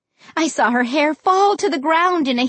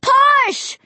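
very high, drawn-out straining shout; frantic full-out command; yell; 13 semitones higher, 4 dB louder than usual. A narrator's reading. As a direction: yell it with all your effort. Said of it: "I yelled".

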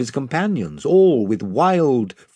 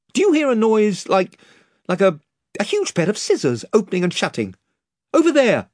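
high, halting, rushed worry; nervous question; 8 semitones higher, faster than usual. A narrator's reading. Eustace nervously asks Rhinelf about a noise, high and halting. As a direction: stammer it out, spooked.

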